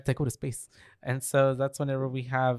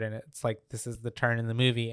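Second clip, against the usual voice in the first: ordinary talk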